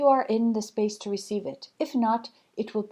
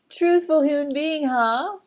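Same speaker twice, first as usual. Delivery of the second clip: higher, louder, laughing echo of the phrase; amused repeat; 5 semitones higher, 6 dB louder, slower than usual. Real, not acted. Back in her own voice, amused by the phrase.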